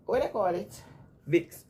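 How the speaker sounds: normal talking voice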